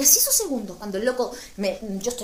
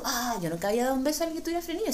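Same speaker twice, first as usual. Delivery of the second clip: usual speaking voice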